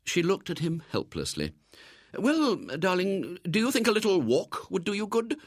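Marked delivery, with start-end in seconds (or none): 2.2-5.4 coaxing mother. Acted.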